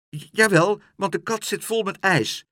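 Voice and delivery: put-on stammering little girl; flustered child. A narrator's reading. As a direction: grab at an excuse, stumbling at first. Child stammers a desperate excuse.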